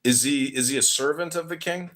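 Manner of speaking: ordinary delivery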